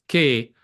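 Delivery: che said "keh", with hard k; Italian pronunciation. Shown as a demonstration. Guest explaining the Italian letters C H E, Italian pronunciation. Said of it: "in Italian is pronou".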